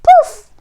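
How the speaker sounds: high, loud magic poof. A narrator's reading. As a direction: pop it out like a spell going off. Magic transformation sound.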